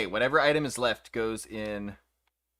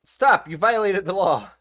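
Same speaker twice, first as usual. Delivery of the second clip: loud, high tickled protest; being tickled; 5 semitones higher, 7 dB louder than usual. Real, not acted. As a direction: shout through tickles. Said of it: "He's tickling me again!".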